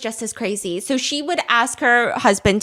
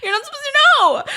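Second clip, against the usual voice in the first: higher, faster mock scold with a laugh behind it; 9 semitones higher, faster than usual. Wife scolding her husband, higher mock scold. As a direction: wag a finger at him, playfully. Scolding him for telling others.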